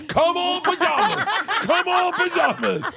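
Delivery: family chanting through laughter; family cheering. Retold or imitated. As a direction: chant it like a crowd losing it.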